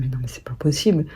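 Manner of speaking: soft whisper to herself; hushed private realization; whispered thought. Retold or imitated. Inner thought after reflecting.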